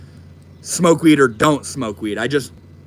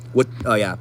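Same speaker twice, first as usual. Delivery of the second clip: normal talking voice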